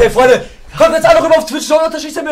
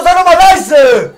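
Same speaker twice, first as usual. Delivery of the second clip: annoyed shout; 5 semitones higher, 7 dB louder, much slower than usual. Real, not acted. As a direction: yell over the noisy friend, stretching it. Tells the noisy friend to shut up.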